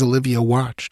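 plain reading voice

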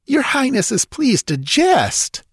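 slow, very high-pitched protest; indignant cry. A narrator's reading. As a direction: protest as an affronted nobleman. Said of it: "cried Thespides".

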